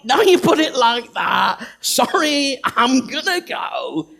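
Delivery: loud, high, willing shout; hammed-up eager volunteer; eager yes. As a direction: burst out agreeing, all in. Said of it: "He's not, like, saying".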